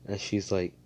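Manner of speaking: ordinary delivery, nothing marked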